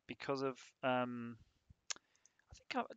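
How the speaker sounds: usual speaking voice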